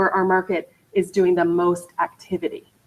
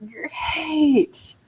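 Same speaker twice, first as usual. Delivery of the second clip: much higher, soft, fake-bright "Great"; forced cheerful great; 8 semitones higher, 4 dB quieter than usual. Answering a seller's million dollar listing request with fake cheer. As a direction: sound politely pleased but not excited. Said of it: "guess what I say?".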